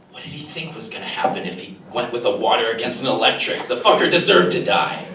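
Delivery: mock the seething fan, building to a laugh; imitating an angry nerd. A fuming Pokemon nerd ranting about the dead driver. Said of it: "they're just like".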